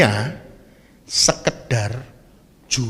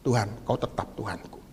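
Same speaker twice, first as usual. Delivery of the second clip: quiet prayer; 7 semitones lower, 9 dB quieter than usual. Pretend. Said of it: "Masih bilang enggak sama Dia".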